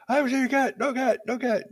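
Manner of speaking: very high, loud mock-panic squeal; panicked neighbor. Startled neighbor denies seeing the cat, hammed-up panicked squeal. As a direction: squeal denials like someone fleeing in alarm. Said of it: "And I startled him".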